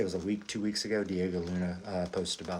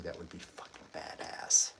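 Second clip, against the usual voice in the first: whispered; 4 semitones lower, 5 dB quieter than usual. Real, not acted. Excited aside about Kenobi.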